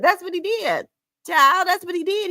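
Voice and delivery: high sassy gossip about the man. Real, not acted. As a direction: squeal it like juicy gossip. Gossiping, shocked tone.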